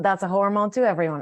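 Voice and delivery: ordinary talk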